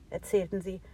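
ordinary delivery

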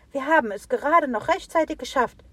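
high, loud animal chorus; excited animals. A narrator's reading. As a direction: report back out of breath.

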